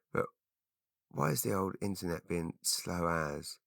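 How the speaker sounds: soft, grumbling at the screen; muttering to self. Real, not acted. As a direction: mutter it under your breath. Muttering at slow internet.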